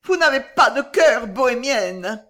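high, loud, frantic reproach; cri affolé. A narrator's reading. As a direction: cry out frantically. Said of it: "cria-t-elle affolée". (reproche crié, affolé)

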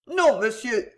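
ordinary delivery, nothing marked

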